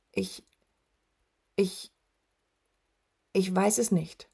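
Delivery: halting, with long gaps between attempts; hesitant, broken-up stammer. A narrator's reading. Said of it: "stammelte Atreo".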